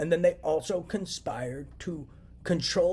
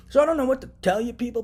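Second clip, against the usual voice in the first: higher and louder, anger rising; getting angry; 7 semitones higher, 9 dB louder than usual. Real, not acted. Vlogger tells viewers he is getting angry, louder. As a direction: let the frustration break through. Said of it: "I'm getting really angry".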